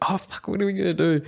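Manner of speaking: hammed-up panic, no idea what to do; mock panic. Friends panicking on bus.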